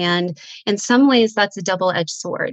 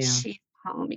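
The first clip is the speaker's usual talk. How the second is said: lower, softer, rising tenderly; 8 semitones lower, 8 dB quieter than usual. Retold or imitated. Mother recalling her daughter's words, soft and low. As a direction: let it fall hushed and gentle.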